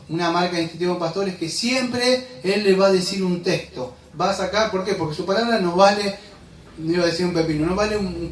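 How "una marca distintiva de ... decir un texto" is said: usual speaking voice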